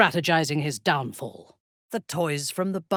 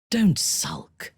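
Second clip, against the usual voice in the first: ordinary talk, nothing marked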